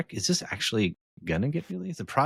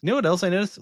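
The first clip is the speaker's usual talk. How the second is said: raised to cut through; louder, higher repeat; 11 semitones higher, 3 dB louder, faster than usual. Real, not acted. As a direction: say it again over the other guy. Repeats louder to get heard.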